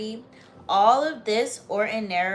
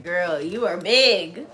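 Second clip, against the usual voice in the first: teasing the dog; 6 dB louder, slower than usual. Real, not acted. Host teasing her big dog in her lap, slow playful scolding.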